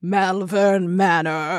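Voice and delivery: very slow, ominous gentleman's voice. Acted. Co-host repeating the spooky name on cue, ominous gentleman. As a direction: stretch each word like an ominous gentleman. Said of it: "say it like an ominous gentleman".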